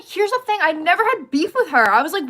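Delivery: ordinary talk, nothing marked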